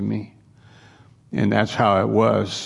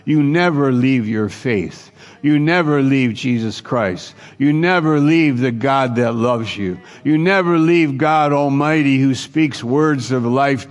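each "never" punched in a rising preaching refrain; stress on never; 2 semitones higher than usual. Real, not acted. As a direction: drive home "never" line after line. Preacher repeating warning.

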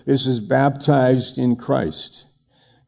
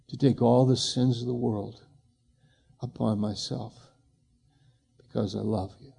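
tender voice of the Lord; 4 dB quieter than usual. Retold or imitated. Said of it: "And he goes".

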